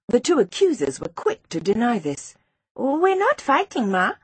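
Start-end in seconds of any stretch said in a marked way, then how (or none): 2.7-4.1 high-pitched protest; slow, high, indignant denial; crying out. A narrator's reading. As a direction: deny it to the teacher in a high child's voice. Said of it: "cried Tapiwa".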